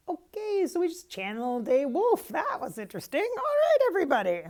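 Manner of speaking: high, loud, buzzing with excitement; loud excited return to the room; excited burst. Real, not acted. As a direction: burst out of the trance fired up. Coming out of channeling a wolf.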